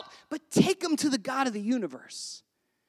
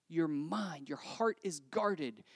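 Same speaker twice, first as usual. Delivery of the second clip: normal talking voice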